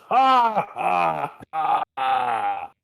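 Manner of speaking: big booming demon belly laugh. Acted. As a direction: laugh from the gut, loud. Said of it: "This time it's a belly laugh".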